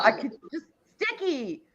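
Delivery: ordinary talk, nothing marked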